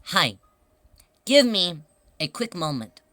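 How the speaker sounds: measured, polite customer-service voice with deliberate pauses; model shop employee. Shown as a demonstration. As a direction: demonstrate the proper answer phrase by phrase. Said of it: "I would say".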